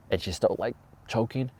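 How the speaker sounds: usual speaking voice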